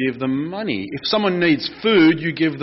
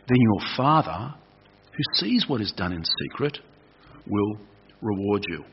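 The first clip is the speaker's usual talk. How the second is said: measured Bible reading, settling softer and lower toward the end; scripture reading; 4 semitones lower, 4 dB quieter than usual. Acted. Pastor reading the verse to the congregation, solemn and measured. Reads scripture passage aloud.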